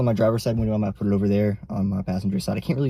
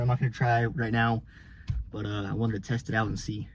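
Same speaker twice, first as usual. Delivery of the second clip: ordinary delivery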